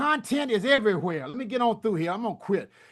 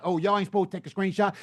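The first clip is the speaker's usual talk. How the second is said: ordinary talk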